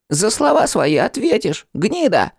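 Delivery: high-pitched rodent threat; squeaking rat. A narrator's reading. Rat threatening the man who insulted him, shrill squeak. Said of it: "Он то ли свистнул, то ли пискнул".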